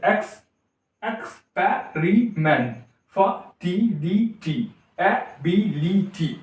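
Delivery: experiment, fertility and ability slowly, each in four separate beats; short gaps inside experiment, fertility and ability; syllable by syllable. Shown as a demonstration. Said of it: "तो उस तरीके से".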